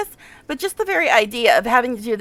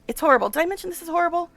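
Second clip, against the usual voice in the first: usual speaking voice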